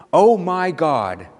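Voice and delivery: slow, drawn-out proclamation; proclaimed exclamation. Acted. Moved viewer praising the photography, proclaiming it slowly. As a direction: proclaim it in wonder, stretching each word. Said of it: "and proclaims".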